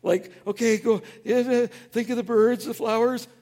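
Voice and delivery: high, loud, flustered inner voice; panicking, trying to calm yourself down; frantic self-talk. Retold or imitated. Pastor acting out his panicked self, frantic and loud.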